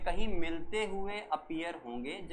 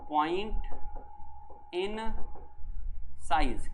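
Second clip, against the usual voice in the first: spaced out word by word; slow dictation; much slower than usual. Shown as a demonstration. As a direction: pause after each word so they write. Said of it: "तो लिख दो". (हर शब्द अलग अलग लिखवाना)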